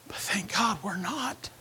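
slow, soft whisper. Real, not acted. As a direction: breathe it out quietly. Hushed aside.

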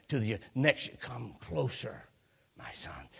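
put-on hushed, low, breathless old man calling his son; fading father's voice, barely above a whisper; dying old father. Dying Isaac whispering to his son to come closer.

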